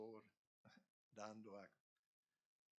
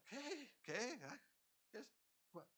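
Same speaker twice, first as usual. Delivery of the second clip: confused; 12 semitones higher than usual. Retold or imitated. Startled confusion among the sheep.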